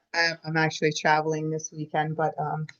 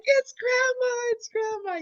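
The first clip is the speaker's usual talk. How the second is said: much higher, delighted repeated cry; excited kids; slower than usual. Retold or imitated. Nieces and nephews recognizing grandma in the ladybug, high and excited. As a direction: nieces squealing at the sign. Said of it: "they're like".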